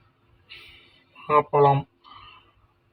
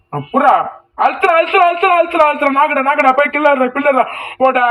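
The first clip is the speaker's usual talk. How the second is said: frantic urging; 9 semitones higher, faster than usual. Real, not acted. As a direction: scream the command again and again. Urging during a fight.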